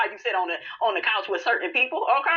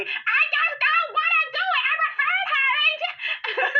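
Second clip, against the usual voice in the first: much higher, slow, laughing whiny mock; mock Simone; slower than usual. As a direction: whine it like a pouting Simone. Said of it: "Simone was huffing and puffing".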